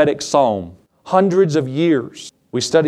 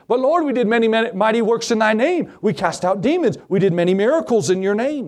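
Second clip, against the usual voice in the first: protesting plea; 7 semitones higher than usual. Acted. Protesting crowd at judgment.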